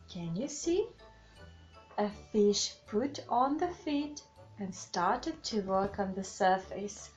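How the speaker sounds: plain reading voice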